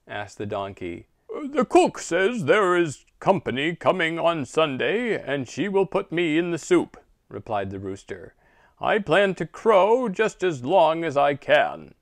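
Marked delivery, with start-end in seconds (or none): 1.3-7.0 higher, louder squawking rooster; a frantic rooster crowing out fears; loud panicky rooster. Acted. Rooster frets about soup. 8.8-11.9 higher, louder squawking rooster; a frantic rooster crowing out fears; loud panicky rooster. Acted. Defiant rooster.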